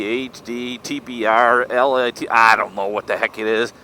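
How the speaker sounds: ordinary delivery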